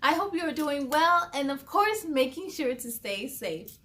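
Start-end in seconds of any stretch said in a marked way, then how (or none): none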